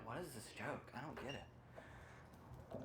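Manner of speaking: slow, soft, breathy puzzlement; quietly baffled by the joke; mock confused mumble. Atheist audience member not getting church jokes, muttering.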